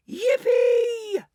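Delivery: high, loud whoop of joy; joyful yell. A narrator's reading. Grandpa Joe cheering the news to Charlie, a high loud whoop. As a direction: let the old man whoop with delight. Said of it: "he yelled out".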